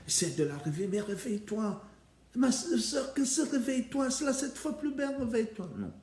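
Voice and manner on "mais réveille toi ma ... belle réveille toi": high, desperate begging; pleading to wake. Acted. Février begging her fallen sister to wake, high and desperate. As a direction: plead with her to open her eyes.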